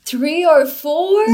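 high, loud, slow incredulous echo; can't believe the number, drag it out; surprised disbelief. Real, not acted. Surprised at four cups of tea.

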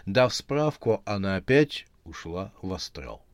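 ordinary delivery

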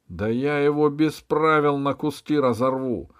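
высокий яростный крик с угрозой; завопи, угрожая сопернику; вопль. A narrator's reading. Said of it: "завопил маркиз". (яростный вопль)

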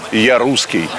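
ordinary delivery

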